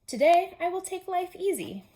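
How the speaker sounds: high, loud lazy Toad. Acted. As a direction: announce a lazy day gleefully. Toad's voice in story.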